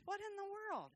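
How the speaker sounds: high, soft incredulous question; baffled God. Retold or imitated. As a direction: ask it in hushed bewilderment. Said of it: "God looks at us sometimes and says".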